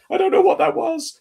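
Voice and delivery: a high, put-on voice, like the Community dean after his rap; squeaky high dean impression, confused by himself; imitating the dean. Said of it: "he's like".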